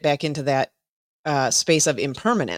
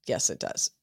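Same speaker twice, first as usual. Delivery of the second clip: soft whispered repeat of agreement; whispering her agreement back; whispered echo; 8 semitones lower, 6 dB quieter than usual. Real, not acted. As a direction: breathe it out under your breath. Agreeing, then echoing softly.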